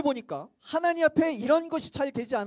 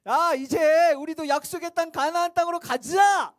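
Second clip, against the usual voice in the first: excited cheer; 3 semitones higher, 5 dB louder than usual. Acted. Freed Israelites cheering to go.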